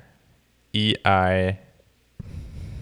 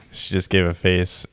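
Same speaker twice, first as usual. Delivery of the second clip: usual speaking voice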